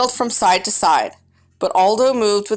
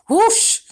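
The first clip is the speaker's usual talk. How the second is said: whispered whoosh; 13 semitones higher than usual. A narrator's reading. Hamster dives to the table.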